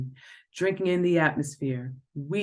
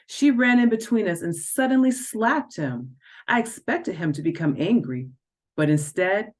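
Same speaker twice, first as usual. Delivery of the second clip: usual speaking voice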